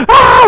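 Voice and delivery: high acted wail; mock crying yell. Teacher showing how children yell when crying, high mock wail. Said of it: "울었을 때".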